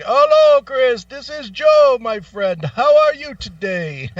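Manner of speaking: loud, high eager caller; imitated telemarketer. Host mimics telemarketer's loud high greeting. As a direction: greet like a pushy salesman.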